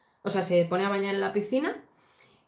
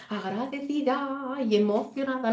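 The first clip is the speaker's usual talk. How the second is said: mock royal formality; 5 semitones higher, slower than usual. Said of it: "que le ha faltado decir".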